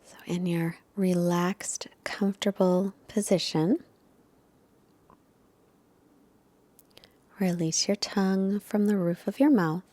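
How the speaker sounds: hushed relaxation cues, trailing into silence; guided meditation. Real, not acted. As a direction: gently settle them into stillness, leaving space between phrases.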